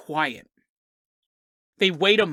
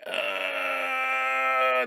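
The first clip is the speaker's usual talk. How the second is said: pained cry; 5 semitones higher, 5 dB quieter than usual. Acted. Wounded Bigfoot screaming in pain.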